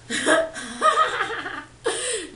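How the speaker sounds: a real laugh breaking out; high-pitched laugh at her own joke; high burst of laughter. Real, not acted.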